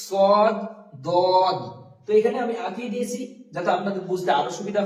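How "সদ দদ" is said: সদ and দদ said louder, heavy, tongue edge on gums; teacher drilling সদ and দদ loudly; emphatic letter demo. Shown as a demonstration. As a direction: punch out সদ, দদ firmly. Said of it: "জিভের কিনারাটা লাগিয়ে উচ্চারণ করতে হবে".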